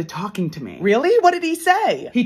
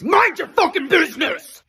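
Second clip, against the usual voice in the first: loud, higher snapped retort; angry shout; 6 semitones higher, 4 dB louder than usual. Retold or imitated. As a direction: snap it back at her. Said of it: "looks at me and goes".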